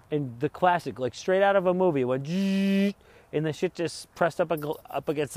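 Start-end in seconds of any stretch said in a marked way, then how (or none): 2.0-3.0 machine buzz imitation.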